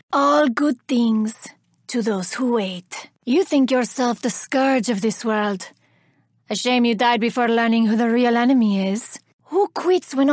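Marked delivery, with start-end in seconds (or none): none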